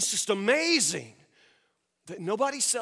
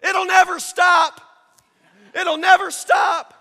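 loud, high, drawn-out yell; full-voiced naysayer's holler; heckler's shout; 8 semitones higher, 10 dB louder than usual. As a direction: shout it stubbornly across the water. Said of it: "the man on the shore shouts".

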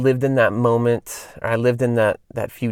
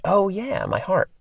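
sudden realization; 8 semitones higher, slower than usual. Retold or imitated. Realizing what Larry meant.

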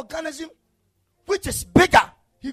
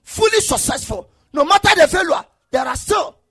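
rising, half-sung preaching over the music, louder and higher; preaching at full force; 5 semitones higher, 8 dB louder than usual. Real, not acted. Pastor stirring the congregation, preaching at full force. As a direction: build the count to a shouted climax. Builds the fourteen generations climax.

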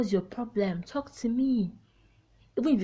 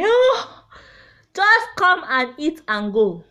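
higher, louder brush-off; dismissive scolding; 7 semitones higher, 6 dB louder, slower than usual. Retold or imitated. Telling the crying mourners at her funeral to just eat and go, higher and louder. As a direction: wave the mourners away.

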